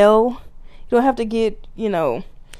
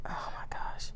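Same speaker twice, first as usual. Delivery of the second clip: dismayed whisper; 19 dB quieter than usual. Real, not acted. Dismay at the low figure.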